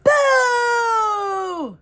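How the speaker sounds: high, loud drawn-out screech; ghostly screech. A narrator's reading. Ghost screeches at the girl, long high and loud. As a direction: screech it long to scare her. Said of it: "Cuco screeched".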